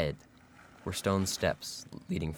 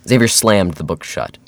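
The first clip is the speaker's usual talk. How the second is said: ordinary delivery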